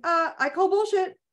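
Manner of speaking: high, firm call-out on bullshit; defiant call-out. Shown as a demonstration. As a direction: throw it back at the pain. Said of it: "or going".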